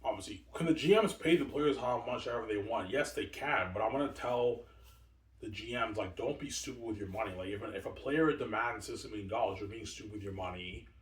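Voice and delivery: ordinary talk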